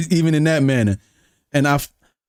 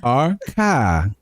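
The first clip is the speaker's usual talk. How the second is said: CAR with Boston dropped R; Boston accent. Shown as a demonstration. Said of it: "this is how you sound like you're from Boston".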